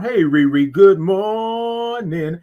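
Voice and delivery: long, slow, loud sing-song greeting; drawn-out morning greeting. Acted. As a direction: stretch every sound like a morning show host. Greeting Riri in stream.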